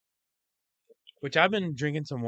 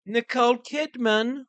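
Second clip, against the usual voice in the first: mock Australian accent; 12 semitones higher than usual. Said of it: "that was more Australian".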